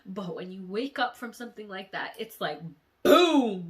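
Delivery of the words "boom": very loud shouted boom; sudden boom. Acted. Describing the jolt of waking up. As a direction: hit it like a crash. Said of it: "waking from the dream".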